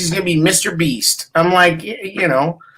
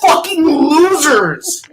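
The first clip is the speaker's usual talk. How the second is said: shouted, higher, slowed-down curses; angry rant; 5 semitones higher, slower than usual. Real, not acted. Mocking podcasters, he yells in angry rant. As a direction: blow up at the fake congratulators. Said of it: "And that is your rant".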